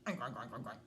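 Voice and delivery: low, rapid oinks; pig oinking. A narrator's reading. Imitating pig in read-aloud story.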